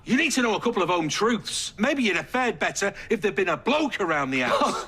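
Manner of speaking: high-pitched, exasperated lecture; worked-up, cutting rebuke; heated telling-off. Acted. Angry lecture in family row.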